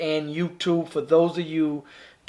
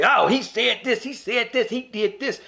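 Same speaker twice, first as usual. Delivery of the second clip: higher mock whiny wife voice; 6 semitones higher than usual. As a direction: ham up her made-up story, pitched higher. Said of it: "made up a story".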